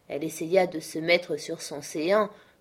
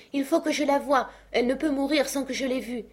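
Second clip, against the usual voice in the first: frantic father shouting at the locked door; desperate cry; 8 semitones higher than usual. A narrator's reading. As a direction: beg to be let in. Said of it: "s'écriait".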